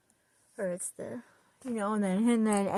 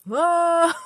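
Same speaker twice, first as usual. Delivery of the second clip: sudden high loud "Ah"; jolted by a realization; startled gasp; 9 semitones higher, 10 dB louder than usual. Retold or imitated. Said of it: "You go".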